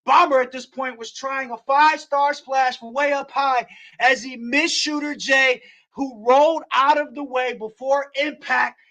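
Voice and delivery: excited wrestling play-by-play; play-by-play call. Acted. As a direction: call the match like a ringside announcer.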